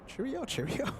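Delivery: high, soft mock British "Cheerio, cheerio"; a playful fake British goodbye. Host playing at British to a viewer, soft mock British accent. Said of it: "the British say cheerio".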